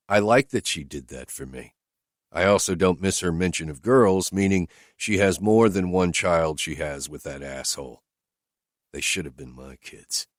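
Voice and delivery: ordinary delivery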